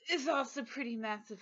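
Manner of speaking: usual speaking voice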